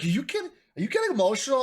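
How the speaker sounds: high-pitched imitation of a wife; incredulous wife. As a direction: voice your wife teasing you. Voicing wife's incredulous question.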